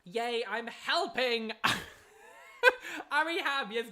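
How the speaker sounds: slow, loud, high cheer melting into laughter; excited. Real, not acted. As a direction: cheer at the alert popping up. Super chat alert celebration.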